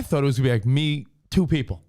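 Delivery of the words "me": ordinary talk, nothing marked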